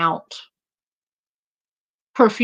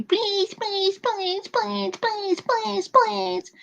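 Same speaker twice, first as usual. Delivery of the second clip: very high mock-nasal pleading; whiny, nasally imitation; 13 semitones higher, much slower than usual. Host imitating the begging that irritates them, whiny nasal mockery. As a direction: mimic her whine in a high nasal pitch. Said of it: "with that whiny ass nasally".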